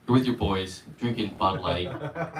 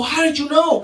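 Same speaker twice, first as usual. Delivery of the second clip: much higher, astonished question; amazed gasp; 12 semitones higher than usual. Retold or imitated. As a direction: gasp it like a stunned believer. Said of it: "And people go".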